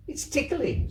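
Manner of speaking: put-on very high little girl; giggly child. Kezia telling her grandmother the bed tickles, very high. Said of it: "said Kezia".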